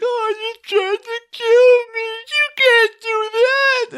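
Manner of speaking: high, slow, sobbing whimper of a pathetic little guy; whiny pathetic Scorpio. Retold or imitated. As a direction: play the beaten villain squealing for pity. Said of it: "Scorpio's response to that is like".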